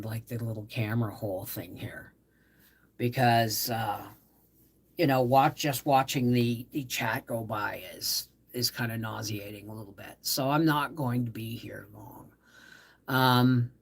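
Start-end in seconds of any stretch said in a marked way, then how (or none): 0.0-2.1 whisper. Real, not acted. Migraine, whispering. 7.4-12.3 whisper. Real, not acted. Migraine, whispering.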